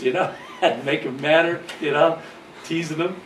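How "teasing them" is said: ordinary delivery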